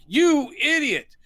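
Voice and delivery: high, loud, drawn-out indignation; mock outrage. Gang leader to the server who spilled wine on him, hammed-up outrage. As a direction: scold theatrically, stretching the words.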